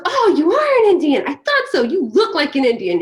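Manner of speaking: hammed-up drunk man asking loudly; drunk neighbor's friend. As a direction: blurt it like a tipsy stranger. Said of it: "And he goes".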